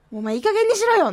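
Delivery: high-pitched acted telling-off; mock scolding. Host quotes her opening skit, mock scolding. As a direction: scold like a comic skit retort.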